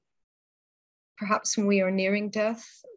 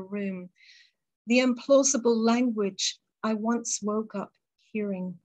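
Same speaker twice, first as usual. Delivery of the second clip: poem recital. Acted. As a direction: hold the lines gently and let the pauses breathe. Reads her deathbed poem.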